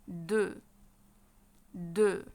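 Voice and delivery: "دو" as French deux, ending on the vowel; silent X. Shown as a demonstration. Teacher models number two for students, "دو" with silent X. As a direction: say "دو" with no X or S sound. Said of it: "الإكس ما بتنطقش".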